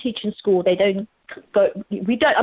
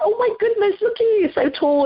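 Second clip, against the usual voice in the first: fond surprise; 13 semitones higher than usual. Retold or imitated. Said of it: "you're like".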